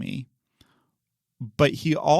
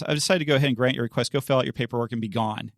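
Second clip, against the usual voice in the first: brisk ruling; much faster than usual. Retold or imitated. Judge's rapid ruling.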